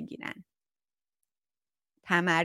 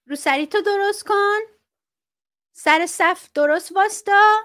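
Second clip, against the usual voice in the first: much higher, clipped strict school orders; mock strict school principal; 9 semitones higher, 4 dB louder than usual. School official orders pupils in line, stern mock commands.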